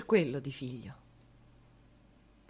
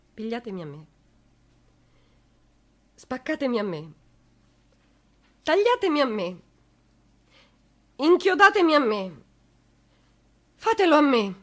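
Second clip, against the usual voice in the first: building plea; 3 semitones higher, 5 dB louder than usual. Acted. Mother begs to take son's place.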